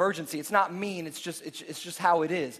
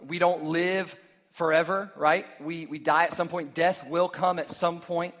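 normal talking voice

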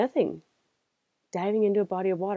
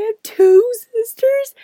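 much higher and louder squeaky question; stunned disbelief; 12 dB louder than usual. Retold or imitated. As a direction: ask it like the world just changed. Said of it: "He's like".

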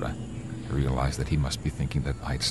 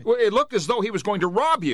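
high, loud, fast pleading; frightened pleading; 4 dB louder, faster than usual. Acted. Driver pleading with the stand owner, scared and high. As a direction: beg for help with mounting fear.

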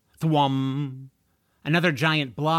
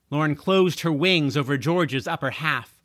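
plain reading voice

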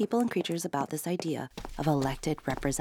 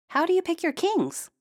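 high, louder question; curious princess; 4 dB louder than usual. A narrator's reading. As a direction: ask it cheekily. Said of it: "asked Princess".

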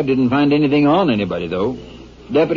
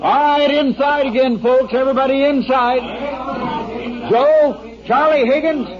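pitched-up call to the crowd across the lot; 10 semitones higher than usual. Acted. Sheriff herds dance crowd inside.

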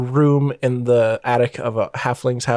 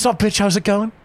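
higher-pitched casual Australian greeting; Aussie character voice, pitched up; Australian accent; 7 semitones higher than usual. Acted. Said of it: "do an Australian accent".

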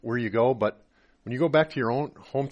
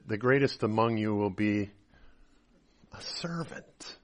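soft quote; 5 semitones lower, 7 dB quieter than usual. Retold or imitated. Quoting Jesus softly.

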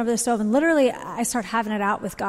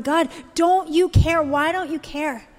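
retold tearful plea; 5 semitones higher, slower than usual. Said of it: "crying before the Lord".

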